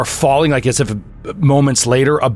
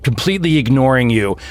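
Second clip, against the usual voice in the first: usual speaking voice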